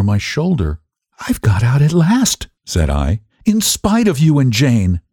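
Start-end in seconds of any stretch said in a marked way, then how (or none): 1.3-2.5 high-pitched, understated triumph; a touch of mad glee, pitched high; mild triumph. Acted. Madwoman triumphant before fainting husband. 3.4-5.0 high-pitched defiance, climbing to the end; rising, triumphant insistence; defiant triumph. Acted. Madwoman triumphant before fainting husband.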